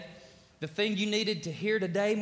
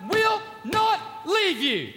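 preaching shout; 14 semitones higher, 8 dB louder, slower than usual. Acted. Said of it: "I'm telling you".

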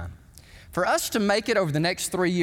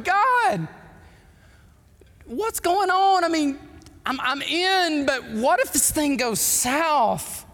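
mock pleading prayer; 8 semitones higher, slower than usual. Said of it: "and I'm going".

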